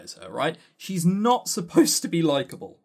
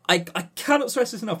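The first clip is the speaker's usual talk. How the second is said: ordinary delivery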